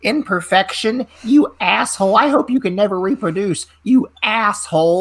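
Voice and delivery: high-pitched mock outrage of an angry woman; indignant woman's tirade, acted out; irate yelling woman. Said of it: "she's yelling at me. She's freaking out".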